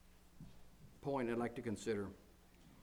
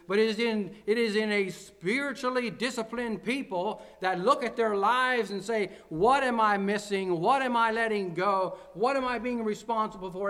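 loud, higher-pitched, emphatic sermon delivery; impassioned preacher in full flow; forceful preaching; 6 semitones higher, 7 dB louder than usual. Real, not acted. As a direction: drive each point home to the congregation. Preaches with raised, driving force.

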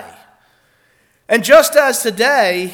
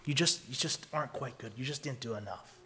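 much softer, lower murmured reproach; hushed mock rebuke; 10 semitones lower, 18 dB quieter than usual. Preacher voicing what God won't say, a much softer low murmur. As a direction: drop it low, like a rebuke you'll never hear. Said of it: "God's not gonna say".

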